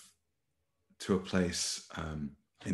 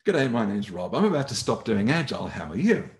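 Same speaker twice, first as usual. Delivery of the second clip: a louder, higher, blunt G'day from Rob; blunt loud greeting; 6 semitones higher, 8 dB louder than usual. Retold or imitated. General manager greets the trainer he blames, loud and blunt. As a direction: bark the hello like a warning.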